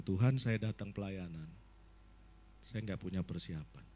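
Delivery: low, hushed pleading to God; humble prayer. Retold or imitated. Pastor admitting to God he is unprepared, low and humble. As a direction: pray it low and humbly, like confessing.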